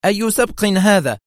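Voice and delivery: high, edgy question; nervous irritation. A narrator's reading. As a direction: ask tensely, on edge. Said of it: "جعلاها تقول في عصبية". (نبرة عصبية عالية)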